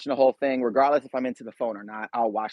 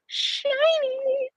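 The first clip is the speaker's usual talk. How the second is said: squeaky silly voice. Acted. Sudden high silly voice aside.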